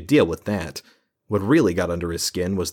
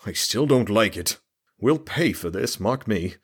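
put-on higher, fretful old mayor conceding; the mayor gives in, higher and uneasy; grudging mayor; 3 semitones higher than usual. A narrator's reading. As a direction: give in, but grumble a warning.

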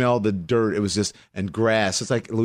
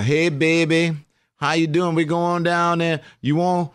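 higher-pitched, slurred Louisiana country voice; dad's Louisiana drawl; 8 semitones higher than usual. Acted. Imitating her dad inviting family down, Louisiana drawl. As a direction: call it out like a Louisiana dad inviting folks to eat. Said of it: "my dad would talk".

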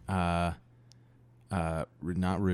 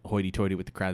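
ordinary talk

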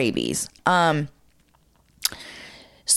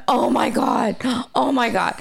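higher-pitched mock freak-out; retold panic; 5 semitones higher than usual. Storyteller reliving finding a dying skunk, retold panic. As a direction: reenact a breathless, pitched-up panic. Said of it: "I start having a nervous breakdown".

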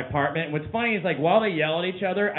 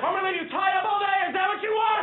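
much higher, scolding shout at a dog named Neighbors; comic owner bellowing at the dog through the walls; yelling at the dog. Retold or imitated. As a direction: holler at the dog so the neighbors hear. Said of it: "every time I yell at my dog".

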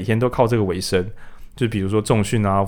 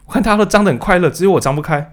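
high-pitched mock bewilderment; 假装困惑抱怨; 7 semitones higher, 3 dB louder than usual. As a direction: act out a puzzled complaint, voice raised. 扮演手张不开时的困惑抱怨. (装作委屈不解，音调偏高)

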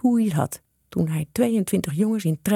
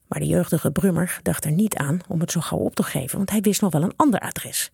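ordinary delivery, nothing marked

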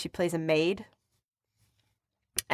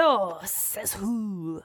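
put-on slow, drawn-out French accent; put-on French movie voice; 3 dB quieter, much slower than usual. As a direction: greet listeners in a hammed-up French accent. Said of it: "Can you do the rest of the show".

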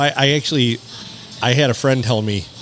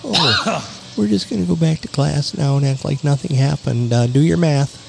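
mock teacher; 2 semitones higher than usual. Imitating teachers sending kids back to class, hint of forced cheer. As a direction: brush it off like a nervous teacher hiding a disaster.

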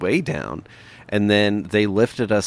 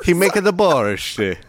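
put-on Ukrainian accent; 9 semitones higher, 4 dB louder than usual. Joking Ukrainian voices.

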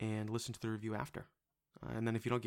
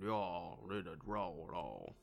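low, swallowed Florida panhandle drawl; mock panhandle growl, trailing down deep; guttural Southern accent; 4 semitones lower, 5 dB quieter, slower than usual. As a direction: imitate a guttural Southern accent, sinking lower. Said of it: "it was very guttural and".

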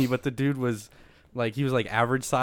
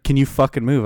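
louder, insistent retold challenge; imitated angry demand; 2 semitones higher, 7 dB louder than usual. Stranger repeating himself to seated passenger, mock angry demand. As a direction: mimic a stranger losing patience.

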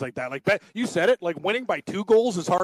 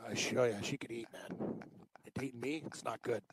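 near whisper, hushed; lowered voice; 6 semitones lower, 15 dB quieter than usual. Real, not acted. Whispering about his girlfriend upstairs, hushed. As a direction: keep it down so she can't hear. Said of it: "I like how Cam lowers his voice".

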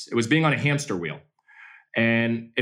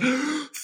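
put-on kid's gasp; 13 semitones higher, 3 dB quieter than usual. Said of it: "He goes".

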